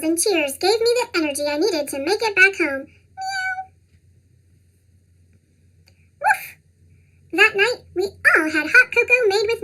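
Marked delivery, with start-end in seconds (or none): 2.9-3.7 tiny high cartoon meow; squeaky cartoon voice. Cat meowing. 6.2-6.6 high squeaky dog bark; squeaky cartoon voice. Dog barking.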